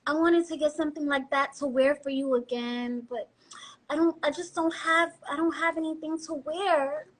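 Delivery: sugary, pleading sweet talk to a man; pouty girlfriend angling for a shopping trip; coaxing girlfriend voice. Shown as a demonstration. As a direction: coax him into paying, all honey and pout. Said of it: "And you'd be like".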